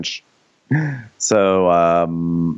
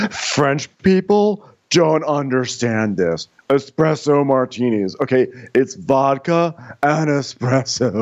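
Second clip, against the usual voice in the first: higher, slowed-down show-off voice; know-it-all American; 6 semitones higher than usual. Retold or imitated. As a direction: lecture like a self-satisfied American tourist. Acting out Emily drunkenly explaining cocktails.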